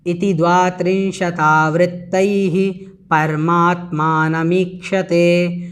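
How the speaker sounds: slow, measured chanting of the Sanskrit verse; slow devotional recitation. Shown as a demonstration. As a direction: intone it slowly like a sacred recitation. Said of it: "इस प्रकार से इसका उच्चारण हुआ".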